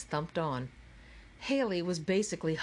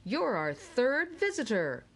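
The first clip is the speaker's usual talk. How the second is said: jolly ceiling announcer booming to Haley; 6 semitones higher than usual. A narrator's reading. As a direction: boom it like a game-show host. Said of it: "boomed the jolly announcer in the ceiling".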